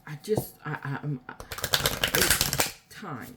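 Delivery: normal talking voice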